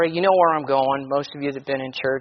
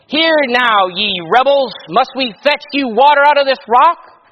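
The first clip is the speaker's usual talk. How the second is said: angry Moses; 8 semitones higher, 9 dB louder than usual. Acted. Moses rebukes the people.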